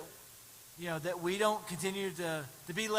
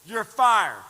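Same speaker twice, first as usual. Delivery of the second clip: loud; 10 dB louder than usual. Acted. Crowd told to say it.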